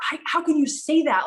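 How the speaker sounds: high, loud indignant retort. Retold or imitated. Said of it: "when I was like".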